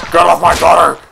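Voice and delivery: loud, outraged dad voice; protective father yelling. Acted. Father defending his innocent daughter, loud outrage. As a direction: shout them away from your daughter.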